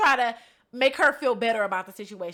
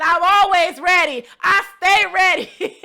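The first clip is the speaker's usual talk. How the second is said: high shouted bravado breaking into laughter; loud hyped boast; 7 semitones higher, 13 dB louder than usual. Retold or imitated. Retelling the answer to a friend asking if she's ready, shouted boast. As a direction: belt it proudly, then crack up.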